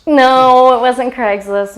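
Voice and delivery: ordinary talk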